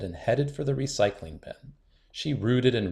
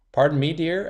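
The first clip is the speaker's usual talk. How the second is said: teacher asking again; 6 semitones higher, 6 dB louder than usual. Acted. Teacher didn't hear her.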